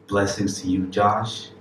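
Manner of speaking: ordinary talk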